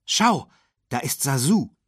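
lightly voiced animal mother; elephant mother pointing out. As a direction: point him out to the little ones.

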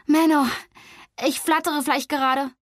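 Flori shaking with nerves over the letter; jittery excitement. Acted. Flori bursting with jittery excitement at the news.

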